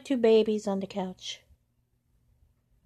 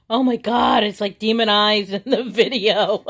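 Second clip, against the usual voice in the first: much louder, higher amazed outburst; excited shock; 3 semitones higher, 11 dB louder than usual. Real, not acted. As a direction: react loud to the glowing eyes. Shocked by demon eyes.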